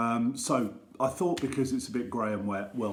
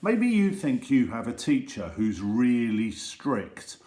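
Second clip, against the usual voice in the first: plain reading voice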